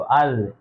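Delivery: अज with a soft dh, no whistle; soft dhal. Shown as a demonstration. Teacher shows students the soft zaal sound. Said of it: "लेकिन जाल हो तो अज".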